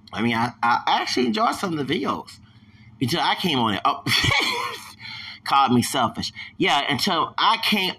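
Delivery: usual speaking voice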